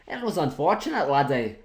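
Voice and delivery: playful put-on Scottish brogue; Scottish accent. Gamer lamenting bad luck to viewers, in a put-on Scottish accent. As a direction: slip into a mock Scottish accent. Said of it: "as I slip into my Scottish accent".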